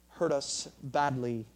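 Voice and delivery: ordinary delivery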